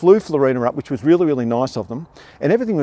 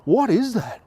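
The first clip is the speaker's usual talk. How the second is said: slow, high startled gasp of a question; pulled up short by a sudden pain; 8 semitones higher, much slower than usual. Retold or imitated. Man in shopping centre struck by chest pain, startled and slow. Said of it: "And I went".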